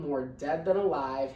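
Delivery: ordinary delivery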